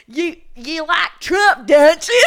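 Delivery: high, loud, drawn-out Aussie taunt; slow Australian jeer building to a shout; mock Australian accent. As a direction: needle them in an exaggerated Australian voice. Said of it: "they were ripping into me".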